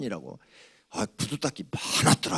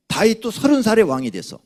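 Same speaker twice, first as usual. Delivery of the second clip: higher, louder pulpit drive; preaching to the congregation with rising force; fervent preaching; 9 semitones higher, 8 dB louder than usual. Acted. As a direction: build the point with rising fervor. Pastor preaching.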